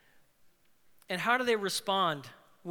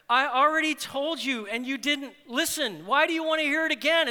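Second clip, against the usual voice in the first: exasperated; 6 semitones higher, 6 dB louder than usual. Acted. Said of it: "my favorite nameless character".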